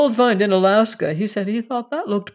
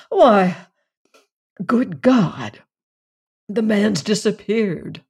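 exclaiming; 3 semitones higher, 3 dB louder, slower than usual. A narrator's reading. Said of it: "exclaimed Gies".

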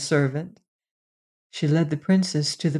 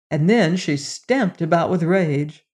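plain reading voice

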